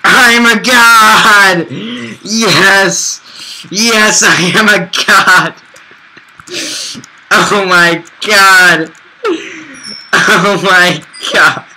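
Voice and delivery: slow, loud triumphant god-gloating; megalomaniac rant. Acted. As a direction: crow over the iron like a god. Gloats after finding lots of iron.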